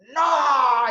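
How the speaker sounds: sharp shouted no; angry refusal. Retold or imitated. Wife refusing her husband, shouted no. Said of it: "Y ella me dice".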